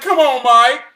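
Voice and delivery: loud, rising come on in disbelief; he can't be serious, push it harder. Retold or imitated.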